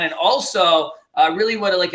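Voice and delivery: ordinary delivery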